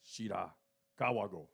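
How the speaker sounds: attempted Japanese accent. Shown as a demonstration. Co-host reading sake label aloud, modest attempt at Japanese accent. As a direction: read the name in a mild Japanese accent. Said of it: "do this in my Japanese accent".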